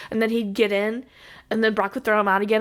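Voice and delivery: ordinary talk, nothing marked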